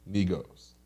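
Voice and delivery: ordinary talk, nothing marked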